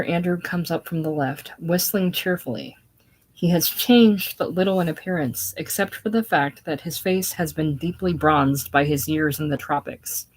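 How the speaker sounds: ordinary delivery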